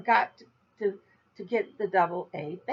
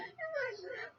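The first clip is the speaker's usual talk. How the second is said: high giggle; 15 dB quieter than usual. Real, not acted. Laughing at herself.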